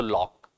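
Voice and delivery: लॉक with ऑ between आ and ओ; half-open lock. Shown as a demonstration. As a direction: say लॉक, neither लाक nor लोक. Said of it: "ला और ओ के बीच में". (सही लॉक)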